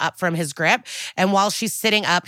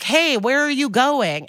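high, slow calling-after; mock yell after someone running off; imitated yelling; 7 semitones higher than usual. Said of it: "And he's yelling".

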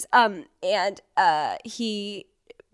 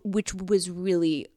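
ordinary talk, nothing marked

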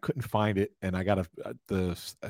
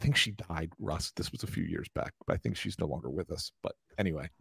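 whispering; 4 semitones lower, 7 dB quieter than usual. Real, not acted. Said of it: "Why are we whispering?".

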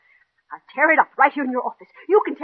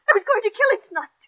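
shocked confession; 11 semitones higher than usual. Acted. Confessing a planned murder.

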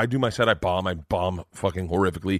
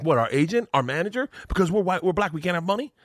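raised pitch, mock-indignant questioning; indignant challenge; 8 semitones higher than usual. Celebrity confronting waitress over the bill, indignant. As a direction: challenge her with rising questions.

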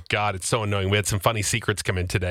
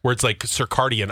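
ordinary delivery

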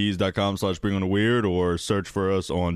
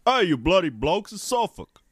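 higher put-on British accent, Suffolk said SUFF-uk; 9 semitones higher than usual. An Englishman correcting the hosts' Suffolk, mock British accent. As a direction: play a cheeky English local setting them straight. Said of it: "how they would pronounce it over there".

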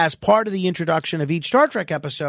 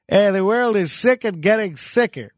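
imitating Bob; 3 semitones higher than usual. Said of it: "Bob used to say".

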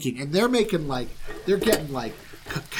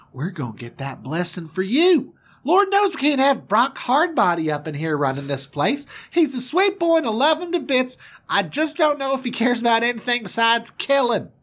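high-pitched, fussing Southern mama; southern twang; 9 semitones higher, 4 dB louder than usual. Acted. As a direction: gush and pep-talk in a high Southern twang. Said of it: "she's got that southern twang".

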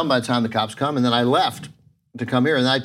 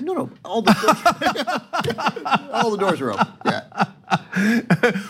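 laughing disbelief; 11 semitones higher, much slower than usual. Real, not acted. Laughing retelling of the unlocked car.

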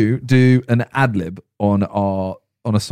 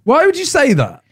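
dismayed protest; 9 semitones higher than usual. Real, not acted. Host scolds friend for jinxing his immunity, dismayed.

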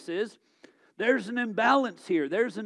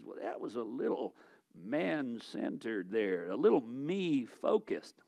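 mock critic; 8 semitones lower, 6 dB quieter than usual. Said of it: "someone could jump out and says".

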